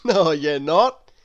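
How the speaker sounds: a stout friendly man correcting her; Mister Happy. A narrator's reading. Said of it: "said Mister Happy".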